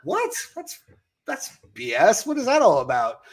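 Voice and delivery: acted-out indignation with a high-pitched "What?"; mock affronted, breaking off and restarting; offended teen. Speaker replays his younger self hearing the nickname's origin, offended. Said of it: "like, offended".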